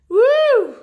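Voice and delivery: loud, high "woo"; excited whoop. Real, not acted. Chatting to viewers before the song, excited whoop. Whoop between bits of chat.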